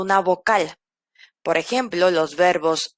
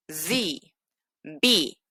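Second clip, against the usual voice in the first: usual speaking voice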